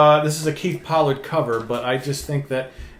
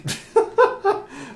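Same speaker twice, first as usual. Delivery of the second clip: airy laugh. Real, not acted. Laughs at the joke.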